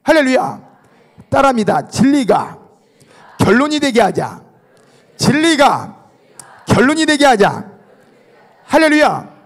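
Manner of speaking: loud, high-pitched sermon call; rally the congregation to repeat after you; preacher ringing out each declaration; full-force preaching. Acted.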